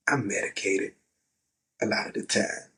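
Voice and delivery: ordinary talk, nothing marked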